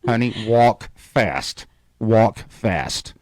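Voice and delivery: slow, low, urgent warning; low and slow, hurry her along; husband urging wife to hurry, slow low warning; urgent, hushed. Retold or imitated. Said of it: "And I'm like".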